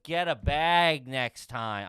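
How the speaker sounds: very slow, mildly scolding; slow scolding janitor. Retold or imitated. Janitor lecturing him about using a bag, slow and deadpan. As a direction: drag out the advice like a tired janitor. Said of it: "He's like".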